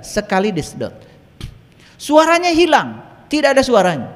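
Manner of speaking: high, loud, ringing proclamation; preaching at full force. Acted. Preacher telling a friend's testimony to the congregation, full-force preaching.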